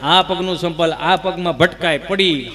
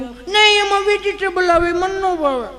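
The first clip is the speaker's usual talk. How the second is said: very high, drawn-out whine; bratty child; 13 semitones higher, slower than usual. Acted. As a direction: whine out a spoilt refusal. Spoilt child refuses food. (જીદ્દી બાળકનો લાંબો કકળાટ)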